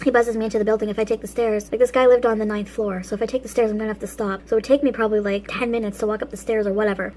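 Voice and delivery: ordinary talk, nothing marked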